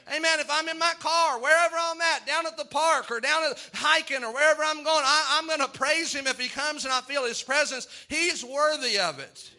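high, driving preacher's call; fiery preaching. Real, not acted. Preacher urging the congregation to turn loose, fiery and high. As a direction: lift the whole church with it.

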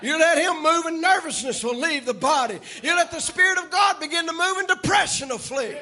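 preaching at full force. Acted. Preacher exhorting the congregation, full-force preaching. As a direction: preach it out high to the church.

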